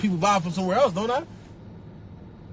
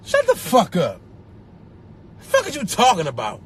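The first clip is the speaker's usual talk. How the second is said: annoyed dismissal. Acted.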